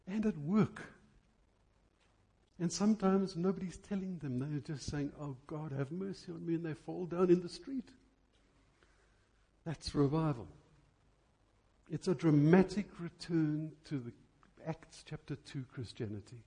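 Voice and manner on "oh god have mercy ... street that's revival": ordinary talk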